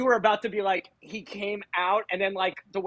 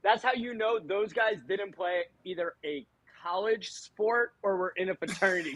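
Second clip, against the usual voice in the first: ordinary talk, nothing marked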